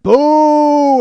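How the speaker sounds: long, loud, high boo; imitated crowd boo. Fans jeering the guard, imitated crowd boo. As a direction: boo like a whole angry audience.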